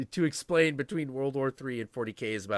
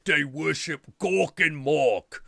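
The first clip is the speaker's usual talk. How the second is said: rough-cut outback Aussie orc, a little louder; orcish Aussie accent; 4 semitones higher, 5 dB louder than usual. Acted. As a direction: put on an uneducated Australian orc accent. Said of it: "they all sound like really super".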